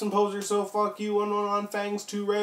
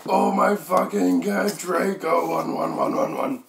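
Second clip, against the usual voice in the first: mock scream; 3 semitones higher, 3 dB louder than usual. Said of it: "I screamed passively".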